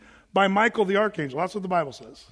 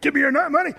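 raised, higher-pitched stick-up order; mock robber demand; 6 semitones higher, 4 dB louder, faster than usual. Robber with a knife to two big guys, mock threatening. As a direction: imitate a would-be mugger demanding cash.

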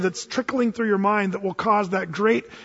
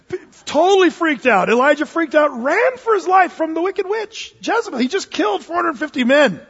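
high, animated exclaiming; worked-up retelling; 7 semitones higher, 4 dB louder than usual. Real, not acted. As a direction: tell it in incredulous excitement. Excited retelling of Elijah's panicked flight.

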